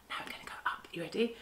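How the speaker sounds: soft whispered instruction; hushed aside. Shown as a demonstration. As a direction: whisper the next step.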